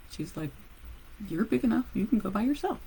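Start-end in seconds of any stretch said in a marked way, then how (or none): 1.3-2.8 put-on mother shooing her kid off alone; mother brushing off. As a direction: toss it off like a busy mom. Mother's voice in retold story.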